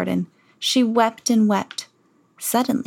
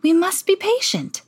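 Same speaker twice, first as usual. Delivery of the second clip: high, meek protesting duke voice; a nervous courtier objecting; timid Grand Duke; 11 semitones higher than usual. Acted. Grand Duke pleads with the king, high and meek. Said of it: "said the Grand Duke".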